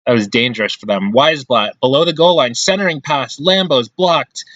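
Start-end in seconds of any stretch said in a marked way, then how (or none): none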